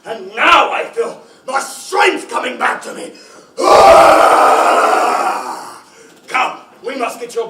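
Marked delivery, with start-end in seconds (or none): 0.1-3.1 loud, rising triumph as strength floods back; a joyous louder declaration; triumphant proclamation. Acted. Aslan revived, proclaiming strength returns. 3.6-5.8 a huge lower roaring cry; loud deep triumphant roar; big roar. Acted. Aslan roars after revival.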